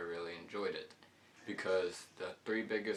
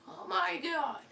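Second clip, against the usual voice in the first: very high squealing yelp; mock terrified yelp; 4 dB louder than usual. Kid on the water slide drop, squealing very high. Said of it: "clench my butt cheeks and go".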